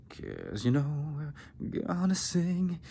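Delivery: slow creaky fry, pitch up; vocal fry. Shown as a demonstration. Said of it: "in that vocal fry".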